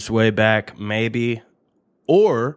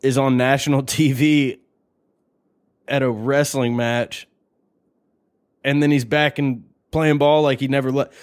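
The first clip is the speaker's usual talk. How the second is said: ordinary talk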